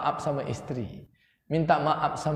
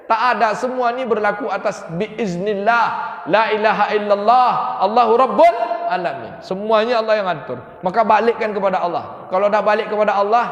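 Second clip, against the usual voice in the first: loud, higher preaching voice, rising to the kalimah; fiery preaching; 5 semitones higher, 5 dB louder than usual. Acted. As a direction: proclaim it to the congregation. Preacher builds to loud dhikr.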